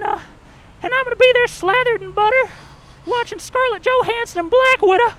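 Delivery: high Southern twang, giddy; a corn cob thrilled to be popped; excited Southern corn. Pretend. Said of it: "same Southern corn, but now it's actually really excited".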